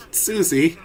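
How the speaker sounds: usual speaking voice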